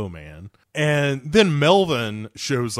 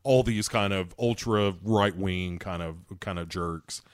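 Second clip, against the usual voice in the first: lower, softer aside voice; low quiet voice; 6 semitones lower, 6 dB quieter than usual. Retold or imitated. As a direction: say it low and hushed. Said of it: "saying, like".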